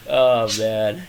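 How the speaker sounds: ordinary delivery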